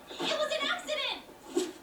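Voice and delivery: drawn-out, high-pitched wail; desperate protest. Acted. Girl insisting to the one she upset, slow high wail.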